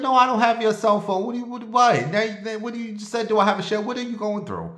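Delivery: high, bewildered, raising pitch at a stranger; mock-baffled outburst, voice up high; exasperated retort. To the man asking for his phone, baffled outrage.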